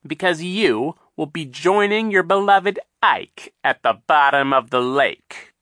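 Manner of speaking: high, loud, mocking villain voice; sly villain Captain Sham. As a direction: sneer it out like a scheming captain.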